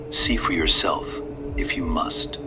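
put-on slow, softly dismissive old man; lightly voiced, unbothered old man. A narrator's reading.

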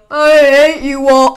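loud hateful villain yell; angry shout. Acted. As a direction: bellow it like a furious villain. Villain Gregory snarls at them.

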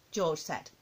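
plain reading voice

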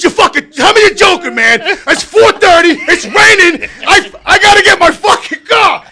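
loud, high, near-crying rant; frustrated rant. Retold or imitated. To the gatekeeper, loud near-tearful rant. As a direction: rant in exasperation, voice cracking. Ranting at tow yard worker.

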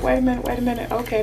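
normal talking voice

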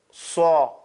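"Saw" with rounded oval lips. Shown as a demonstration. Teacher summing up, rounded "Saw". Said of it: "That's itbaq".

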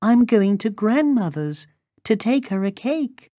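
put-on high little-girl voice. A narrator's reading. Girl tells the wolf her errand, high childlike voice. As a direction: pitch it way up like an innocent little girl.

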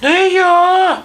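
very high mouse squeak. A narrator's reading. Mouse refuses to knead the dough, squeaking. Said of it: "пищить круть".

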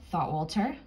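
ordinary delivery, nothing marked

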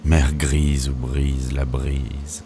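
usual speaking voice

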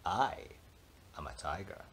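ordinary delivery, nothing marked

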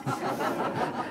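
quiet unvoiced chuckle; soft breathy laugh. Real, not acted. Scientist laughing at his own joke about aliens, soft breathy chuckle. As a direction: let out a short soft laugh.

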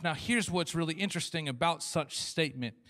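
ordinary talk, nothing marked

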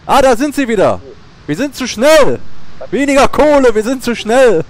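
panicked shout. Real, not acted. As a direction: call out in a panic. Panicked calling to crew.

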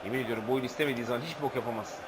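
low, soft, fast grumble; quiet fed-up grumble; grumbling aside. Real, not acted. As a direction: complain under the breath quickly. Frustrated aside.

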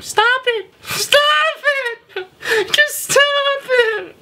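mock falsetto yelling; absurdly high, squeaky mock shriek; weird high-pitched yell. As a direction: imitate someone squealing in a very high voice. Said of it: "with a weird high-pitched voice and starts yelling".

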